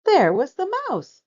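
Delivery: surprised reveal. A narrator's reading.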